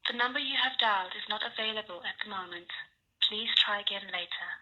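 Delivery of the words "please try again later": normal talking voice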